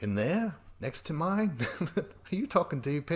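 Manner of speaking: high-pitched, amused disbelief; mock incredulous questioning. Man misreading her game proposal as sexual, mock incredulity. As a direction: imitate a startled man, voice climbing.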